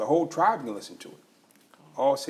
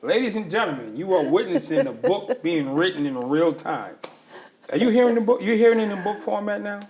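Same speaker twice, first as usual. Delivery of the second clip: louder and higher, announcing to a crowd; mock announcer hype; 5 semitones higher, 5 dB louder than usual. Host mock-announces the mother's book to an imagined audience, excited and loud. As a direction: sell it like a showman.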